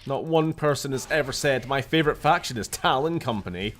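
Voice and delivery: hammed-up loud scolding; loud taunt. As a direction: shout insults at the enemy. Taunting enemies in combat.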